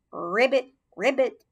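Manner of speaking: a lightly voiced funny frog croak. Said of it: "in a funny croaking voice".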